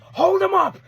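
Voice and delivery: high mock-shouted order; retold yell. Recapping wrestlers fronting each other, yelled order.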